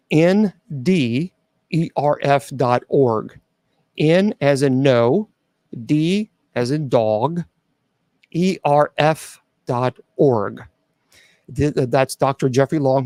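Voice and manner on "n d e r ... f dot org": slow, careful letter by letter spelling; spelled out slowly. Real, not acted. Speaker spelling the site for worried listeners, slow and clear. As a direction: spell the web address for the audience, unhurried.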